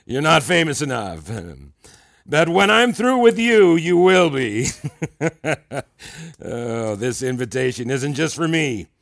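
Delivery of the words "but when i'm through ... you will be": grand boast. Acted. Mentor boasts grandly to his student.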